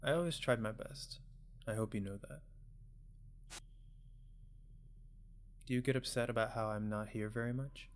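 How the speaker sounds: put-on soft-spoken parent checking in on a child; gentle parent. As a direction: voice a caring parent, quietly. Voices parent talking to child.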